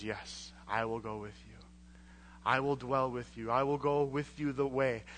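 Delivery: Yahweh's soft, low assurance to Moses; quiet divine promise. Acted. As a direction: hush it down like a tender promise. Yahweh finally granting Moses' plea.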